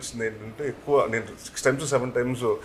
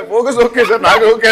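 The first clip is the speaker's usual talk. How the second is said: louder, higher laughing impression of the cameraman; honest cameraman imitation; 9 semitones higher, 10 dB louder than usual. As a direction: play the earnest cameraman, half laughing. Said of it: "అంటాడు ఆయన".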